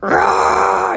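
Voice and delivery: a short mock roar of the pissed-off caterpillar cells; angry roar. Caterpillar cells protesting the change, mock roar. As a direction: growl it out like angry dying cells. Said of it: "they're like".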